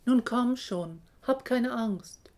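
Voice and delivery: high, loud coaxing call. A narrator's reading. Mother ostrich to her chick, calling out. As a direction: call it out to coax. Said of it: "Jemand ruft".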